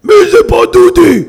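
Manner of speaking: thug shouting. Retold or imitated. Thug searching bus for Dudi.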